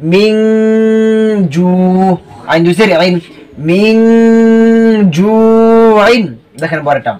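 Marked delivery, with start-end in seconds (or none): 0.1-1.7 মিন with the n held as a long nasal hum, then জু drawn out; hidden n, ghunnah. Shown as a demonstration. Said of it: "গুণনা করতে হবে". 3.7-6.2 মিন held as a long nasal hum, জু stretched before আইন; hidden n, ghunnah. Shown as a demonstration. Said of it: "গুণনা করতে হবে".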